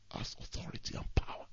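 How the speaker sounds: hushed whisper; whispered with awe. Real, not acted. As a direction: drop to a secret whisper. Whispered point in sermon.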